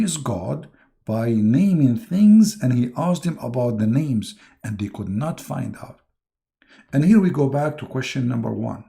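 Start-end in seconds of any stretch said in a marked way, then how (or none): none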